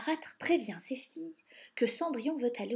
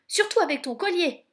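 high, loud cry of enthusiasm; exclaiming; 8 semitones higher, 8 dB louder than usual. A narrator's reading. Gus cheering Cinderella on, exclaiming. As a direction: pipe up loudly and eagerly. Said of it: "s'écrie Gus".

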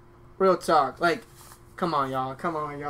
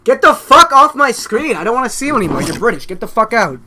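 loud, higher-pitched mock-outraged rant; worked-up, exasperated telling-off; angry dismissal; 5 semitones higher, 7 dB louder than usual. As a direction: order them off the screen, loudly. Ranting at British callers on screen.

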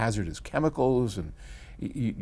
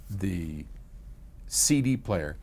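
ordinary talk

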